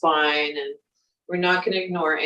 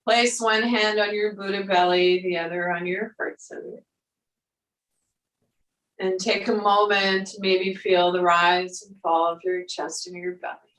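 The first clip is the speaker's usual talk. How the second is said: guided meditation. Acted. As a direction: guide them gently through the breath. Yoga teacher guiding breath and movement.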